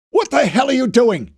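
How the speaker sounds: high, loud furious demand; angry demand. A narrator's reading. Fisher slams Knopf against a wall and demands, furious. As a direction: demand it while pinning him to the wall. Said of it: "he demanded".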